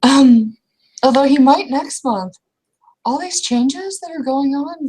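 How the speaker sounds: a jittery rush about the inspector; nervous excitement. Acted. Character fretting about the inspector to her friend, nervously.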